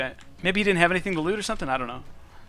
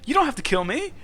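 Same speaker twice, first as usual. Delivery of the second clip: pleading; 7 semitones higher than usual. Pretend. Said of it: "I wish I could talk to these guys in voice comm and just say".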